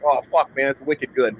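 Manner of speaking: a light, imitated Vermont accent. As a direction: slip into a hint of Vermont talk. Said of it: "picked up a bit of that".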